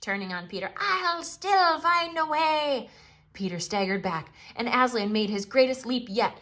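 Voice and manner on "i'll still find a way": high, slow menacing vow; vengeful witch. Acted. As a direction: threaten darkly as a defeated witch. Witch vows revenge.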